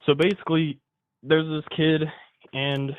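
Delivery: nervous and short of breath; breathless, needs a breather. Real, not acted. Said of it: "hold up, I gotta take a breather".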